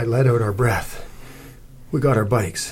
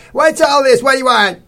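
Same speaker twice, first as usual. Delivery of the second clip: high, loud demanding householder; brusque stout lady; 8 dB louder than usual. A narrator's reading. Stout lady to strangers at her door, brusque. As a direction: challenge the strangers loudly, pitched way up.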